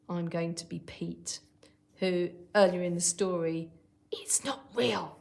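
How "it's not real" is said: whispered hiss. A narrator's reading. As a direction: hiss it with disbelief. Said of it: "hissed Pete".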